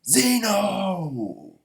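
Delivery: long high scream, falling at the end; screamed name. Retold or imitated. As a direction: scream the name across a battlefield. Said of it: "He screams".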